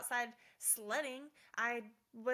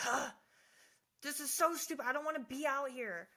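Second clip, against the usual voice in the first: mock annoyed groan, a bit louder; frustrated; 3 semitones higher, 5 dB louder than usual. Recalling shoveling snow, mock frustrated grumble. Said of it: "I'm getting frustrated".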